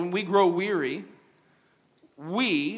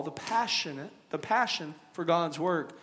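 usual speaking voice

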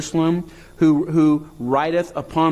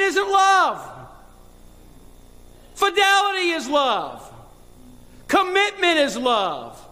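much higher and slow, each line held out; incredulous exclaiming; 14 semitones higher, slower than usual. Retold or imitated. Pastor recalling his reaction to the crying wife, high incredulous. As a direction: proclaim it in stunned disbelief.

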